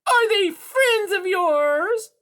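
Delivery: high, loud, slow demand; drawn-out menacing cat question. A narrator's reading. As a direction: ask it like a piercing-voiced villain. Cat interrogates the mouse.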